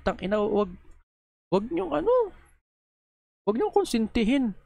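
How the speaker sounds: begging them to stop, outraged; angry pleading. Real, not acted. Vlogger scolds viewers for defending the abuser, outraged high pleading.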